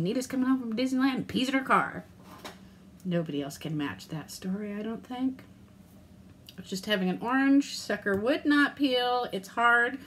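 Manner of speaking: usual speaking voice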